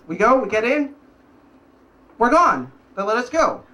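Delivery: ordinary delivery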